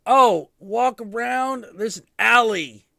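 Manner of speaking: answering the hostel guy across the alley, loud and high; calling back. Retold or imitated. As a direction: call the directions back over. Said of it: "And I'm like".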